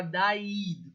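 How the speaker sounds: "daid" as da-EED, long stressed ee; wrong pronunciation. Shown as a demonstration. Teacher shows the double-E mistake, "daid" mispronounced as da-EED. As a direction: stretch "daid" into two syllables, da-EED. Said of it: "se eu colocasse dois Es".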